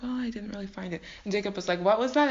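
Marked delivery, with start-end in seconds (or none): 0.0-1.0 soft, quick embarrassed mumble; meek mumble. Acted. Laban admitting defeat to Jacob, meek mumble. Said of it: "probably mumbled to Jacob". 1.8-2.3 pointed question. Acted. Pretends not to hear mumble.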